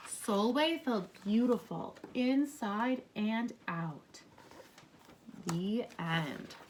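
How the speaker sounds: gentle read-aloud to a class; storybook reading. Acted. Teacher reading a story aloud to her class, gently. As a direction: read it like a picture book for kids. Reads picture book to class.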